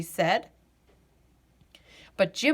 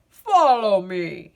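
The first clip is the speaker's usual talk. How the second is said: Santa, beckoning; 8 dB louder, slower than usual. A narrator's reading. Santa inviting.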